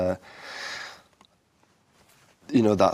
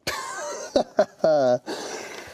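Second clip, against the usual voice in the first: amused laugh; 7 semitones higher than usual. Real, not acted. Laughs at being asked.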